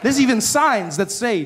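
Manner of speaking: ordinary talk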